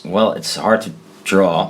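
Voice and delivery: ordinary talk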